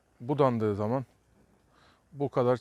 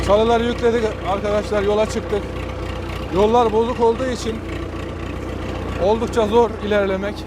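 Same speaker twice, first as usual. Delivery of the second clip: raised voice; 6 semitones higher, 6 dB louder than usual. Real, not acted. Talking over a running tractor.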